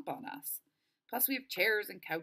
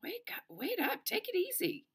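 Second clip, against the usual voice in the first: calling out. Acted. Boy calls after limping Delphi to wait up, high urgent call. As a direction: call after someone, pitched well up.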